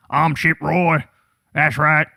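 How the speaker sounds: lightly voiced goofy politician intro; a hint of a cheesy senator introducing himself; mild mock-cheesy "I'm Chip Roy"; mock introduction.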